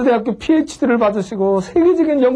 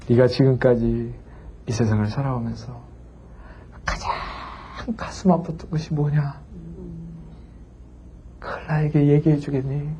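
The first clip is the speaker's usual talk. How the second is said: gentle question; 8 semitones lower, 8 dB quieter than usual. Retold or imitated. Professor asks gently.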